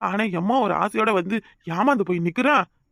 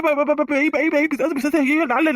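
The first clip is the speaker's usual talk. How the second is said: stuttering high-pitched fright at a ghost; panicked cry of ghost, ghost; terrified stammer; 5 semitones higher, slower than usual. Acted. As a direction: gasp it out as if you've seen a ghost. Waking to find a ghost.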